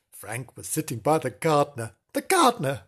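ordinary delivery, nothing marked

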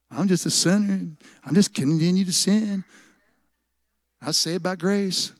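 soft, mock-pitiful churchgoer; silly excuse. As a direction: drag out a feeble excuse, softly. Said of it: "we say silly things like".

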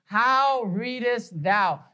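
How readest thou spaced out slowly; slow searching challenge; slow pointed question. Retold or imitated. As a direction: lay each word down deliberately. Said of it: "looks at us and says".

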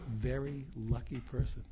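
ordinary talk, nothing marked

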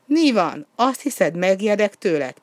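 defiant retort. A narrator's reading. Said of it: "pattant fel Kópé is".

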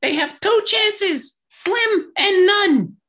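very high, drawn-out squeaky character voice; high-pitched cartoon sidekick impression; Poir. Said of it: "I do enjoy what Poir says".

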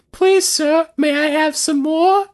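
put-on high pleading child voice; Oliver Twist. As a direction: beg like a small orphan. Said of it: "little Oliver Twist".

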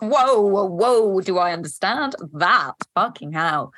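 loud, stunned whoa, drawn out slowly; mock an amazed double take at the words; retelling her own gobsmacked reaction, loud. Retold stunned reaction to letter.

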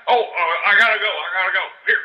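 ordinary talk

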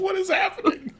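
high, soft, baffled voice; comic squeak of confusion; mock bewilderment. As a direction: imitate a stunned actor in a small high voice. Voicing the bewildered hugged actor.

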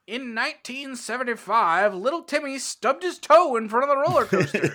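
put-on booming, high old newsreel narrator; old radio announcer. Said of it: "I like your newsreel voice".

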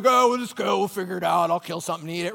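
lightly voiced bloke who'll just wing it; careless guy. Men shrugging off packing lunch.

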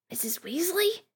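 high, tentative croaked question; mild croak. A narrator's reading. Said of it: "Harry croaked".

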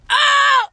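high, loud re-enacted scream; retold scream. Friend re-enacts her shock at the restaurant, high scream. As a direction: scream out in mock horror, high and sudden. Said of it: "Jag bara skriker rakt ut".

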